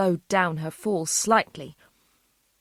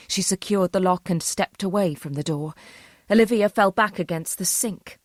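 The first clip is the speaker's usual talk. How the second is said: ordinary delivery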